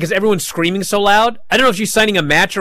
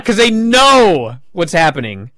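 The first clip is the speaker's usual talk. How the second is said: normal talking voice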